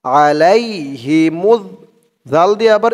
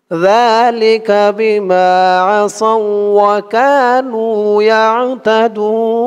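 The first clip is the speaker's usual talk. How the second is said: recite along; 5 semitones higher, much slower than usual. Shown as a demonstration. আমার সাথে বলুন.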